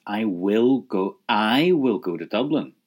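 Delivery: "I" loud and drawn out; stress on I. Shown as a demonstration. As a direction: lean hard on "I". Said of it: "I put emphasis on it".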